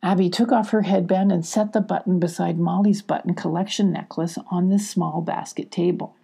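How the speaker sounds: plain reading voice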